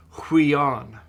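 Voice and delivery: huion stretched out slowly, each syllable clear; slow model word. Shown as a demonstration. Teacher repeats huion slowly so students catch it. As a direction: spell huion out with your voice, slowly. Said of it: "it's got a rough breathing".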